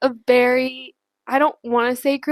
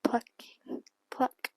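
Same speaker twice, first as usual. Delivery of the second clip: soft whispered plucking demo; ASMR whisper; 12 dB quieter, much slower than usual. Shown as a demonstration. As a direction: whisper each word apart, as in ASMR.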